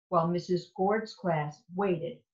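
usual speaking voice

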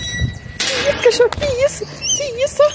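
pet-talk mock astonishment; mock surprise at pet. Real, not acted. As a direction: squeal it at the puppy. Puppy grabs something, mock scolding.

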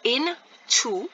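INTO slowly broken as IN ... TO; split into syllables. Shown as a demonstration. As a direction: give INTO two separate beats, IN and TO. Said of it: "is one syllable and two is a next syllable".

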